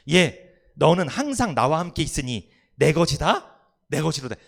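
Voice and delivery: a loud, fond father calling his son; kind father's call. Acted. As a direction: proclaim it like a loving father. 아버지가 좀 좋으신 분이야. (다정한 아버지가 크게 부르는 목소리)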